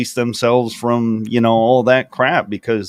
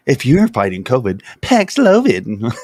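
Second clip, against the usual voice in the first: mock ad slogan. Mock drug commercial slogan.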